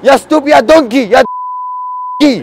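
high, loud indignant yelling; worked-up, high-pitched tirade; outraged shouting. Pretend. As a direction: hurl insults in a huff. Outraged insults after being sworn at.

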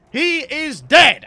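loud, slow announcement; triumphant. Real, not acted. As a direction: declare it in triumph. Kill announced.

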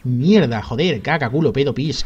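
real furious gamer outburst; angry shout. Real, not acted. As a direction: rage at losing the fight. Raging at boss fight.

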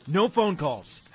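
sudden high, loud outburst; yelling. Acted. John stopping Maya calling her team, sudden yell. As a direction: yell it slowly to forbid it. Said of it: "I didn't mean to yell".

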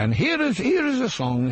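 high, bright DJ announcement; radio presenter voice. Retold or imitated. Comic recalls his Housewives' Choice intro, mock radio presenter. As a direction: announce the record like a cheery radio host. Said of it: "Because I used to say".

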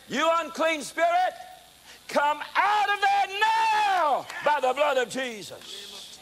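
high, loud and slow exorcism; commanding rebuke. Retold or imitated. Preacher casting the spirit out of the woman, thundering. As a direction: rebuke the spirit with full force.

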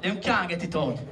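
usual speaking voice

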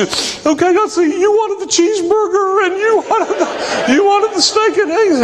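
high, tearful voice breaking into a laugh; teary and giggling while she takes the order; retelling a waitress crying through her words; still crying. Retold or imitated. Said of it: "she's still crying".

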